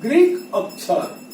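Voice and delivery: "Greek अक्षर" spoken slowly, Greek stressed and falling; slow answer. Shown as a demonstration. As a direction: spell it out for the class. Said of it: "क्या होगा बेटा". (Greek अक्षर धीमे, ज़ोर देकर)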